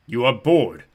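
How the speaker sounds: loud, resonant giant's boom. A narrator's reading. Giant leans down to the travellers, booming. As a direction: boom it out, but not unfriendly. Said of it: "his voice boomed".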